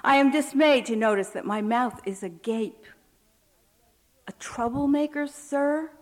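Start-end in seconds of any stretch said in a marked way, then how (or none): 4.3-5.9 stunned echo. Acted. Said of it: "only managed to echo".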